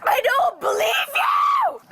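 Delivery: shouting. Real, not acted. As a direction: yell it in protest.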